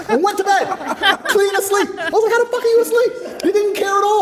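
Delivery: high, loud, laughing through the words; cracking up in disbelief while telling it. Real, not acted. To the hosts, about brother sleeping in jail, laughing hard.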